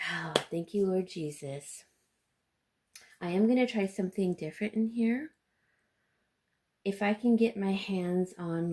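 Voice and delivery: ordinary talk, nothing marked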